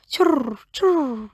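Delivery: high chirped churr churr; high bird call. A narrator's reading. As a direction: chirp like a cross little bird. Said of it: "It said".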